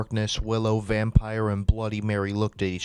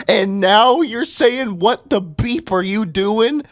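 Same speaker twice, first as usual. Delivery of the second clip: incredulous yelling; 12 semitones higher, 7 dB louder than usual. Real, not acted. Reader at the story's "beep", incredulous high-pitched yelling. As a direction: rant climbing into a very high, loud squeal. Rant peaks at the censored line.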